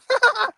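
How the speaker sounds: high creepy cackle; scary laugh. Real, not acted. Streamer startles herself with a high creepy cackle. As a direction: let out an eerie high cackle. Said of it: "That was a really scary laugh".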